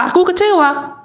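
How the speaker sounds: high-pitched inner-voice retort; the mind contradicting, pitched high; subconscious answering back. Acted.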